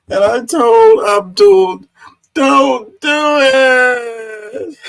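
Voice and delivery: put-on high, slow, quavering old man voice. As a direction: drag it out like a frail old man. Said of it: "the old man voice works really well".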